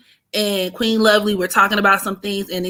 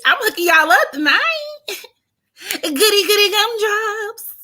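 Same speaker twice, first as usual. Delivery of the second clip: giddy; 10 semitones higher than usual. Real, not acted. Excited about the tarot reading.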